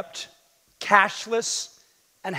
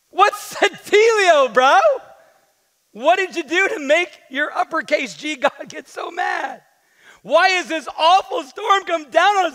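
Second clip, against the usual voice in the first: demanding; 6 semitones higher, 4 dB louder than usual. Acted. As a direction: press him hard for an answer. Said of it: "they demanded".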